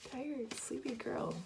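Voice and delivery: slow, whispered self-tease; sleepy whisper. Real, not acted. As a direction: whisper it like you're half asleep. Teasing herself about being sleepy.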